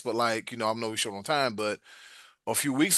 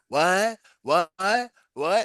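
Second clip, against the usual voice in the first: short, higher-pitched "what?" each time; imitated baffled guest; 5 semitones higher, 3 dB louder, much slower than usual. Guest asked about her dish, mock baffled. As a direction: mimic a guest who just isn't getting it.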